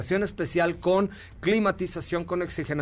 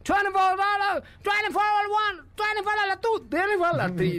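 auctioneer call. Retold or imitated. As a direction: rattle off the bids like an auctioneer. Auctioneer calls the lot.

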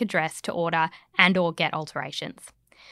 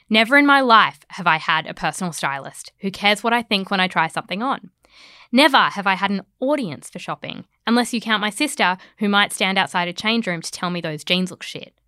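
usual speaking voice

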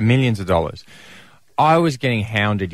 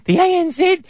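much higher put-on voice of a worried wife; imitating wife. Husband mimicking his wife's worried phone call, high put-on voice. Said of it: "she once rang me and said".

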